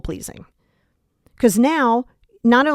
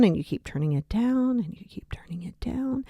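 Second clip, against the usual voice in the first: fading to a whisper; 2 semitones higher, 8 dB quieter than usual. Shown as a demonstration. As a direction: voice sinks to a whisper as the dial turns down. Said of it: "keep turning it down, shown by fading".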